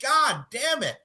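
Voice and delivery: high, heated telling-off; furious telling-off. Pretend. Imagined reply to the president, high angry rant. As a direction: tell the president off at last. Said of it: "And I would be like".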